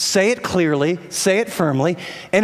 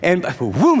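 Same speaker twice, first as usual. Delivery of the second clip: ordinary talk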